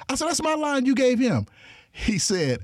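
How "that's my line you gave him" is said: high, heated objection; retelling his indignant cry at the director, pitched high; indignant protest. Retold or imitated. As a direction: protest like your line was stolen. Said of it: "So I'm getting mad".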